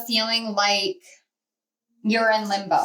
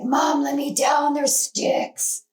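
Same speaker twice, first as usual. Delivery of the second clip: higher, slow, breathy-free pet voice as the dog; a light put-on doggy voice; voicing the dog; 4 semitones higher, slower than usual. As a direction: play the dog begging to be put down. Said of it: "She's like".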